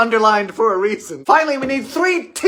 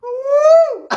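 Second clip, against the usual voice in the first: high, loud squealing ooh; delighted ooh; 6 dB louder than usual. Real, not acted. Baker tastes the sugary dirt cake, squealing ooh.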